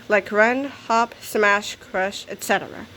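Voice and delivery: ordinary delivery, nothing marked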